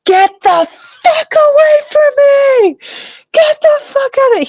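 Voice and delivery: high, loud frantic screaming. Retold or imitated. Screaming at husband to get away from her. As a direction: scream to drive him away. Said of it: "and I'm screaming".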